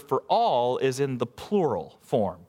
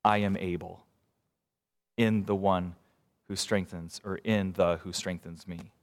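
tender reading; 8 semitones lower, 5 dB quieter than usual. Real, not acted. Preacher drawing out the verse's meaning for the church, low and tender. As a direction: let each phrase sink in gently. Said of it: "I think what we see here is".

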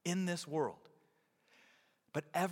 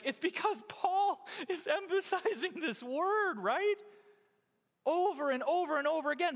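high-pitched, loud protest; mock exasperation; 12 semitones higher, 4 dB louder than usual. Preacher answering his sister's teasing about a favourite word, mock exasperation. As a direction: push the voice way up in comic self-defence.

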